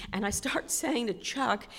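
usual speaking voice

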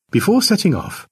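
usual speaking voice

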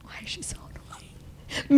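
whispered aside; whispering. Real, not acted. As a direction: whisper it behind her back. Aside about friend, whispered.

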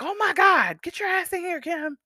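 high, panicked call to a friend in the hallway; alarmed shout. Retold or imitated. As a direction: yell her in, scared out of her mind. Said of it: "was like".

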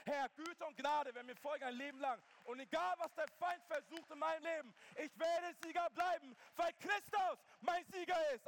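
loud, high, fervent preaching to the crowd; full-force preaching. Acted. Preacher calling the church to hand fear to God, fervent and loud.

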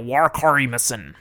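"wakarimasen" with a hard American R, "wark-ar-i-muh-sun"; thick American accent. Shown as a demonstration. Tutor shows how to sound foreign, thick American accent. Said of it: "hit that R real strong".

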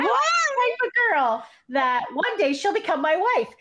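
high-pitched Mickey voice; [name]. Acted. Host reading a boy-band skit in high Mickey voice. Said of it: "I give [name] the high-pitched Mickey voice".

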